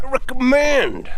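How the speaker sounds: "recommend" stretched out high and bright with glee; exclaimed recommendation. Shown as a demonstration. Cyclist cheering viewers to visit Albania, exclaimed high and slow.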